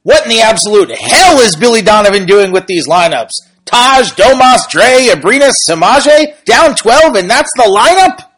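loud, high, indignant ranting; aggressive complaining voice. Retold or imitated. Podcaster reading a fan's tweet aloud in a loud, high, outraged complaining voice. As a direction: read the tweet like a furious fan venting about the lineup. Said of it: "I'm gonna read this in a more aggressive voice".

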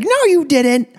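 very high mock mom voice; contradicting mom. Mom correcting dad at the table, imitated in a very high voice. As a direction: squeak out a mom's objection. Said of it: "and my mom was like".

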